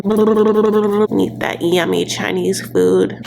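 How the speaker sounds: normal talking voice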